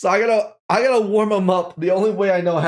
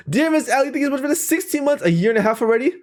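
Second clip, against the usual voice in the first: higher, excited and fast; bright excited read; 6 semitones higher, faster than usual. Real, not acted. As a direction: read it out with delight. Reading a fan message.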